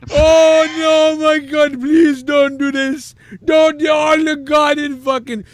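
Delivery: high, loud panicked pleading; screaming victim. Acted. Victim begging the captors to stop, screaming in terror. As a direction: beg for your life at a high shout.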